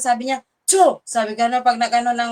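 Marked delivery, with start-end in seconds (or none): 0.7-1.0 a quick sharp spitting sound; imitated spit. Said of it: "halos duraan nila ako". (ginayang tunog ng pagdura)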